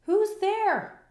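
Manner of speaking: high, loud startled mouse cry; tiny mouse calls out at glowing eyes. A narrator's reading. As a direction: call out high and loud in alarm. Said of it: "Mouse cries".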